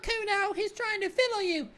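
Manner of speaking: put-on shrill-pitched frantic child call; high alarmed kid. Kid warning Kuno about the cop, high and urgent. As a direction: cry out in panic for a friend.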